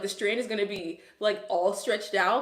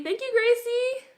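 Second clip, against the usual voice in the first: squealed high thank-you, pitched way up; excited thanks; 13 semitones higher, 4 dB louder than usual. Real, not acted. Thanking a viewer in chat for calling her pretty, excited high squeal. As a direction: gush your thanks like a delighted fan.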